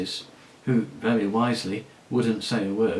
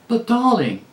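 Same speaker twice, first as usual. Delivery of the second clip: same loud voice; 11 semitones higher, 7 dB louder than usual. Acted. La Saraline answers her friend in a loud gushing voice. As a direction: gush it loudly without a care. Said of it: "in the same loud voice".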